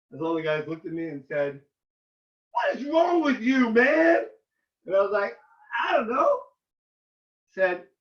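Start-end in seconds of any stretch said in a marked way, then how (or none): 2.5-4.3 high, drawn-out disbelief; retold exasperated question; incredulous reproach. Friends confronting him. 5.8-6.4 high-pitched clueless answer; mock-helpless shrug of a reply; sheepish retold reply. Answering his friends.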